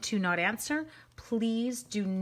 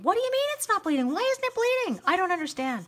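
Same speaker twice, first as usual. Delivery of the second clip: much higher, louder, quick amazed questions; astonished disbelief; 11 semitones higher, 5 dB louder than usual. Real, not acted. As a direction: gasp out the disbelief. Amazed the ink doesn't bleed.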